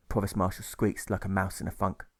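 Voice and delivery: ordinary delivery, nothing marked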